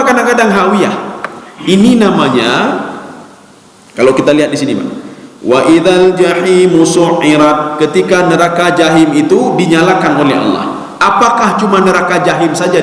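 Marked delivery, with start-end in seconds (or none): 5.5-7.8 slow, chanted Arabic verse; Quran recitation. Acted. Preacher quoting the verse to the congregation, slow chanted recitation. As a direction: recite the verse slowly and melodiously.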